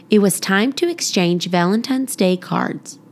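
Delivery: ordinary delivery, nothing marked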